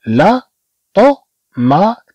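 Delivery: first T of طومات like ط, last like ت; heavy then light T. Shown as a demonstration. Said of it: "وهنا تتنطق بحال التاء".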